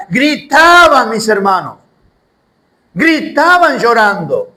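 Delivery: alto y fuerte, predicando con fuerza; predicación intensa. Acted. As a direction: sube la voz como un pastor encendido. Preaching crowd weeping. (predicación a todo pulmón, aguda y fuerte)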